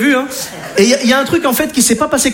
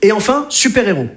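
usual speaking voice

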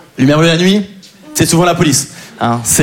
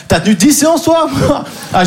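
ordinary talk

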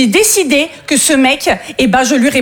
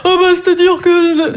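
slow, drawn-out hesitant reply; higher, dragging put-on male voice; laid-back man; 4 semitones higher than usual. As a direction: imitate a guy stalling at his ease. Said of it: "me répond en toute détente".

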